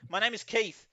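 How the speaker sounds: high-pitched, lightly voiced answer; flustered man. Confused man naming himself to security, flustered. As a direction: offer it like a bewildered witness.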